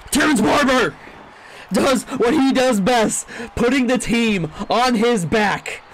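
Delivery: loud, high, slowed hype for the returner; hype call. Real, not acted. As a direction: sell it like a highlight-reel call. Hyping the big kick return.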